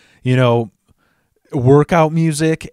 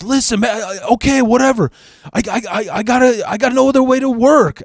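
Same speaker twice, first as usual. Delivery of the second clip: put-on high, stammering Cheech-style Mexican accent; Mexican accent impression; 9 semitones higher than usual. Thief pleads he needs it for work, high Mexican accent. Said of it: "that typical Cheech Mexican accent".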